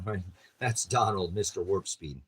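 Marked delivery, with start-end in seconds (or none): none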